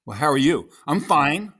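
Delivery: high, loud, punched-up exchange; reactive scene dialogue. Shown as a demonstration. Actor demonstrates reacting to his scene partner, loud and animated. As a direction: throw the lines out big and lifted.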